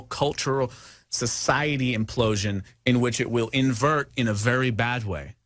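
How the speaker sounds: ordinary talk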